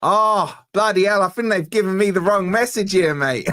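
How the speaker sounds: hamming up an Aussie TV producer's greeting; mock Aussie caller. Retelling an Australian caller's phone greeting, mock Aussie accent.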